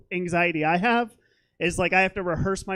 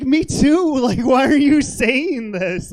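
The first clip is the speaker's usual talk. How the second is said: loud, high mock outrage; indignant comeback; 5 semitones higher, 7 dB louder, slower than usual. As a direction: throw it back, exasperated and much louder. Offended comeback to a backhanded compliment.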